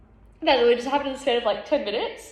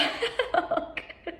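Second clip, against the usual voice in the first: high airy laugh through tears; real laugh through tears; breathy laugh; 7 semitones higher, 6 dB quieter than usual. Real, not acted. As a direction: let a breathless laugh escape. Laughing at her own realization.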